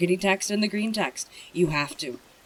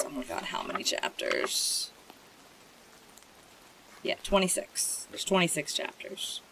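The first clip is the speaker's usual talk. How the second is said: whispered aside; 6 dB quieter than usual. Real, not acted. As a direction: mutter the count under your breath.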